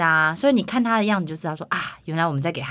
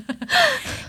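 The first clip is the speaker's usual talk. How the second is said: high rising laugh. Real, not acted. Guest laughs at host's echo. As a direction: burst out laughing.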